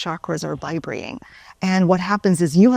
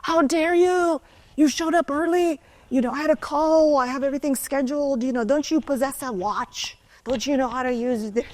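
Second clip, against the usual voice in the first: high-pitched, slowed outraged rant, far above her own voice; furious client; 7 semitones higher than usual. Retold or imitated. Client at the door berates early instructor, high indignant tirade. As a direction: mimic the livid client scolding her for arriving early. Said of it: "he's fuming".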